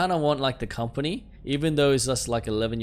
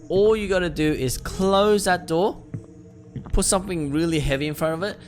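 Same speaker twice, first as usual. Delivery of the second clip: emphatic advice; 7 semitones higher, 6 dB louder than usual. Real, not acted. Player warning viewers about creepy basements, loud emphatic advice.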